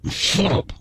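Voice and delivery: urgent whispered "Shut up.". Retold or imitated. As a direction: whisper "Shut up." through the teeth. Hushing friend at security.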